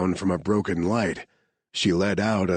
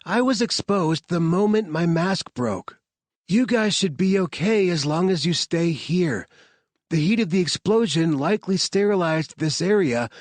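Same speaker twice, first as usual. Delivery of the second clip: higher, insistent; arguing voices; 9 semitones higher than usual. Acted. Scientist overruling the soldiers' protests, higher and firm. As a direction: overrule their objections.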